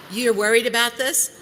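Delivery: loud, high, shocked question; alarmed disbelief. Retold or imitated. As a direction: demand it, rattled and loud. Retold alarm at FedEx people.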